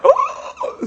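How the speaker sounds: very high laughing "Oh!"; startled laugh. Retold or imitated. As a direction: yelp in shock at the time. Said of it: "I'm like".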